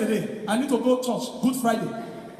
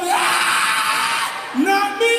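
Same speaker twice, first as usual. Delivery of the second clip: mock shout; 7 semitones higher, 3 dB louder, much slower than usual. Guy shouting in church.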